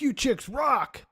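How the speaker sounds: high, drawn-out whoop of praise; mock rousing cheer. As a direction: call it out like a rock fan. Retold thanks called to nuns.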